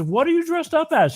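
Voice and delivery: put-on high-pitched question to a child; inquiring old lady. Old lady asking about the costume, high and puzzled. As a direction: pitch it up, trying hard to understand.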